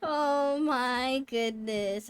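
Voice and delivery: ordinary delivery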